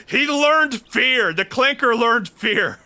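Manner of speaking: dramatic proclamation. Acted. Dramatic reading of game dialogue.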